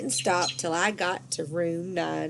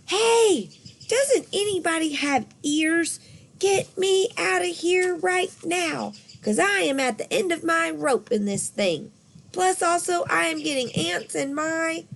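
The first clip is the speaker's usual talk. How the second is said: yelling; 9 semitones higher than usual. A narrator's reading. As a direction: shout to be let out. Yelling from inside the bag.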